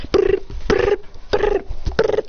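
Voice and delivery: high trilled "brr" bursts; imitated noise. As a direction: trill short bursts in a row.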